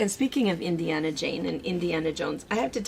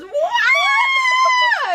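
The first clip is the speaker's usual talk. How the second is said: imitated kid's shriek; 7 dB louder than usual. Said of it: "And the kid goes".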